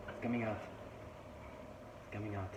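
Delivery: normal talking voice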